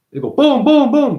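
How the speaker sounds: loud, high mock booms; explosion noises. Mimicking bombs in a war, loud booming. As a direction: boom it out like bombs going off.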